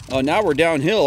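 ordinary delivery

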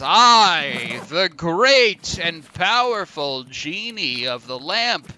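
slow, loud, drawn-out proclamation; grand genie. Acted. Genie announcing himself to the wisher, slow grandiose proclamation. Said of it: "the genie says".

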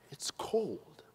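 whispered dismay after a sip; hushed, scandalized British aside; horrified aside. Acted. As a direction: whisper it, quietly appalled. Horrified aside on tasting tea.

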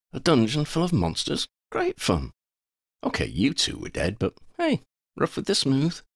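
lightly voiced, high-pitched breezy heroine; Lavender, breezy irony. Lavender jokes about the monster dungeon, breezily ironic. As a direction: toss it off with playful irony.

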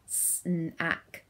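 SNACK split into s, n, ack; sounded out. Shown as a demonstration. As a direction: say SNACK in separate robot sounds. Word sounded out as in game.

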